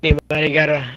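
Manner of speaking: ordinary talk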